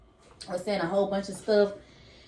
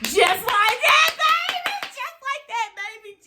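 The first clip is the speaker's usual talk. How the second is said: excited coaxing; 4 dB louder, slower than usual. Real, not acted. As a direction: urge them on with a high sweet squeal. Coaxing encouragement.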